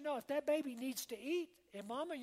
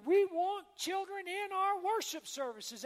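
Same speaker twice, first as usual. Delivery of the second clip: emphatic declaration; 6 semitones higher, 5 dB louder than usual. Real, not acted. Pastor insists children are welcome.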